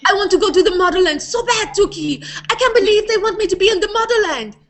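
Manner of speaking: whiny little petite model, begging; high excited character voice pleading to Tuki; Shiraz voice. Acted. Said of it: "Shiraz talks like this".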